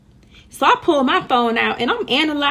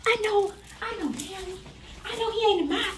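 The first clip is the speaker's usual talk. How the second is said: high mock voice; 10 semitones higher than usual.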